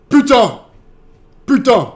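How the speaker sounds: loud, high French exclamation; shouted swearing demo. Shown as a demonstration. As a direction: throw out the curse loudly, voice jumping up. Demonstrating French swear words.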